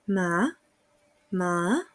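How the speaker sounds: MA starting low and sliding up; second tone. Shown as a demonstration. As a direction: let Ma climb from a low point like a question. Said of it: "parte en un punto bajo y luego sube".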